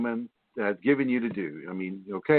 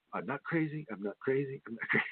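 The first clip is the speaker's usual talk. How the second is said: softer, insisting under the breath; muttered denial; 8 dB quieter than usual. Retold or imitated. Imitating someone in denial about the voices, muttering softly. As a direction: quietly repeat it to convince yourself. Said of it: "I'll just ignore that".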